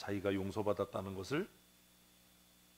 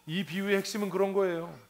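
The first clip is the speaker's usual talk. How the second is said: louder, higher, hammering the point; fervent preaching; 7 semitones higher, 7 dB louder than usual. Acted. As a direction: preach it to the congregation. Preacher drives home the point. (강단에서 힘주어 외치듯)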